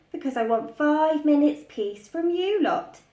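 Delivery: a hint of an exasperated mother; fed-up mum wanting peace; lightly voiced weary mum; mum's voice. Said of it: "said Missus Large".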